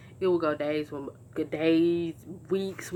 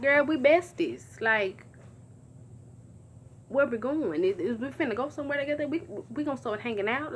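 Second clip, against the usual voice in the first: mock gushing; 8 semitones higher than usual.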